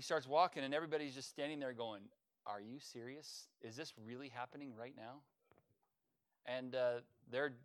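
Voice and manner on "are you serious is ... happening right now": hushed, awed disbelief; softly, as if you can't believe your eyes; stunned hush. Retold or imitated. Bystanders watching the healed man walk, awed hush.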